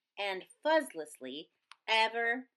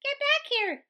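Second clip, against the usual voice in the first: high, urgent yell; 5 dB louder than usual. A narrator's reading. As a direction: yell to call them back. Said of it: "yelled Pip".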